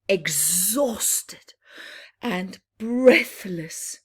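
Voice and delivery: lively read-aloud with bouncing rhyme; rhyming story narration. A narrator's reading. Reading a rhyming bedtime book to children, lively. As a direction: tell it to children, full of drama.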